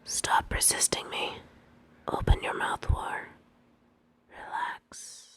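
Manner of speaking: hushed, commanding whisper. Acted. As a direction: whisper the orders close, barely voiced. Commanding whisper during kiss.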